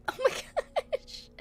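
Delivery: squeaky high, drawn-out gasp of laughter; real laughing. Real, not acted. Streamer cracking up over a meme contest idea, laughing helplessly. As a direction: laugh right through the words, pitch leaping up.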